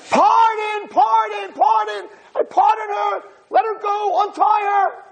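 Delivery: very high, desperate acted shout; frantic screaming. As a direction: scream pleas through a soundproof window. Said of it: "They think he's screaming".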